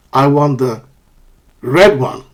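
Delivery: "red" punched after a short pause; contrastive stress. Shown as a demonstration. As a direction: set off "red" against the other choice. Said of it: "using contrastive stress".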